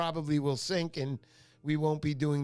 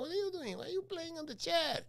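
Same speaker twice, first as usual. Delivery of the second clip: high, softer mock scolding; imitated scolding mother; 12 semitones higher, 5 dB quieter than usual. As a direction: play a fussing mother in a light, high voice. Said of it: "my mother comes and goes".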